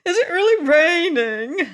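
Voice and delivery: high, loud, tearful questions; on the edge of tears over rain; little man about to sob; starting to weep. Acted. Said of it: "beginning to weep".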